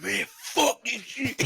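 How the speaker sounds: roommate's high, drawn-out yell; high-pitched mock holler, words stretched; imitated yell. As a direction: mimic a roommate yelling out, high and slow. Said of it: "him just yelling out".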